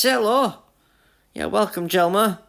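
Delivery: usual speaking voice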